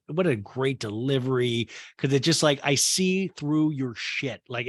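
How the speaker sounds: ordinary talk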